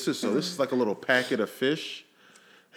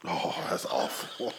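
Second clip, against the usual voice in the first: disgusted by smell; 8 semitones lower, 8 dB quieter, slower than usual. Real, not acted. Smelling the fish.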